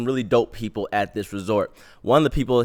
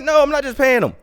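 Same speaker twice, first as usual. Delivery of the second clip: high, loud stubborn refusal; retold refusal; 13 semitones higher, 5 dB louder, faster than usual. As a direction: shout it up high, indignant. Refusing to pay driver.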